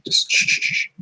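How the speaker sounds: repeated hissy "shh" bursts; voiceless shh, shh, shh in a row; imitated shushing noise. Shown as a demonstration. Player says not to roll the dice, imitated shushing.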